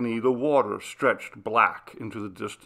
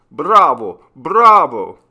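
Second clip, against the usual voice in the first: loud, high cheering; shouted bravo; 4 semitones higher, 13 dB louder, slower than usual. A narrator's reading. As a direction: cheer the singer from the crowd. Applause for the singer.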